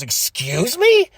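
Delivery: slow, drawn-out indignant diva; haughty mock offense; mock diva outrage. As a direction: stretch each word out, offended. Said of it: "She goes".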